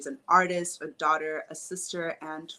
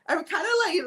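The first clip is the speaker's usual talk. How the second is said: ordinary talk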